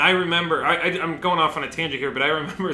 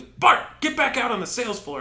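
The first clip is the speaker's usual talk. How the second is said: bossy order; 9 semitones higher, 4 dB louder than usual. Retold or imitated. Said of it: "And he goes".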